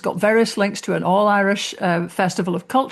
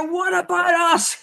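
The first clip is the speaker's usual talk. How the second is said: much higher, whiny mock outrage; mock indignation; 10 semitones higher than usual. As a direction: squeak it like a sulky offended neighbour. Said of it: "got on their high horse and went".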